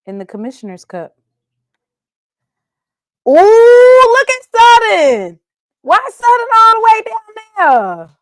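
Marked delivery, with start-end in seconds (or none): 3.3-8.1 high mock gasp. Real, not acted. Mocking rival's low ranking.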